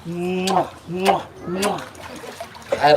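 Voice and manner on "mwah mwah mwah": playful mwah kisses; kissing noises. Pretend. Trainer pretending to kiss an alligator, kissing noises. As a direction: make smacking pretend kisses. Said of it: "kiss him a little".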